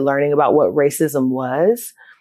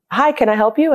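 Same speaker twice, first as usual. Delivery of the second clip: high, polite greeting; bright receptionist greeting; 6 semitones higher than usual. Retold or imitated. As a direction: greet her like a friendly receptionist. Director greeting at door.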